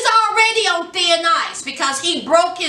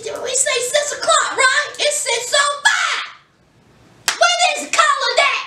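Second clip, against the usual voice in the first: high, sarcastic officer pitched way up; mocking officer; 10 semitones higher than usual. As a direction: needle a late parolee in a mocking pitched-up voice. Officer mocking him for lateness.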